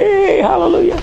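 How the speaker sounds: high, bright mock cheer; high excited hallelujah from the pews; cheering congregation. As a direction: cheer like a whole church crowd. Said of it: "And everybody's".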